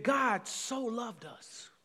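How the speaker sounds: slow "that God so loved us", each word weighed; measured slow emphasis; slow and deliberate. Shown as a demonstration. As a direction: let it land slowly. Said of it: "slow emphasis on so loved".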